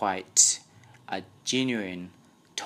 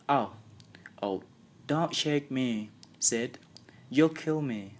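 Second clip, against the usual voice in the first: mock moaning; 5 semitones higher than usual. A narrator's reading. Tom moaning feigned pain.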